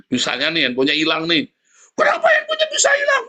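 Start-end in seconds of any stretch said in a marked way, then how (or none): 2.0-3.2 put-on high shrieking yell at the child; a parent losing it over a lost phone, shrieking; mock furious parent.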